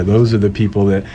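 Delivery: normal talking voice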